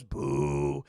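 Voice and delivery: low loud booing; hammed-up crowd boo; deep mock boo. Metropolis crowd boos.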